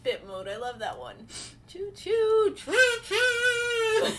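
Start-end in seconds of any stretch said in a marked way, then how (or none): 1.7-4.1 train call squeal. Real, not acted. Bits train arriving.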